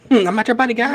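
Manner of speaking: high-pitched cartoon Canadian voice; South Park Canadian. Quoting South Park to friends, high cartoon Canadian voice. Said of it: "South Park ... make fun of Canadians".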